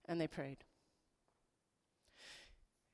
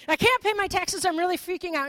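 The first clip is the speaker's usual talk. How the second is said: hammed-up panic, much higher and louder; mock panic; 10 semitones higher, 10 dB louder than usual. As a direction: play the frightened disciple, overdone. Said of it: "This is a paraphrase, by the way".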